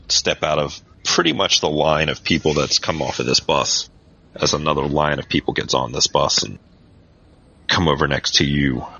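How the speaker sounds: usual speaking voice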